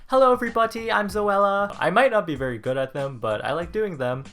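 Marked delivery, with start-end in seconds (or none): 0.1-1.7 high, loud imitation British accent; Zoella's British accent. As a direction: greet viewers in a put-on British accent. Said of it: "A British accent".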